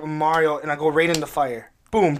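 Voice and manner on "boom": ordinary talk, nothing marked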